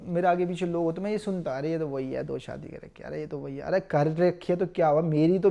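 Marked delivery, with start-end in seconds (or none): 1.6-3.6 low, hushed bystander murmur; softer, lower aside, as onlookers talking behind someone's back; imitated gossip. Mimics people gossiping behind him. (पीठ पीछे की धीमी कानाफूसी की नकल)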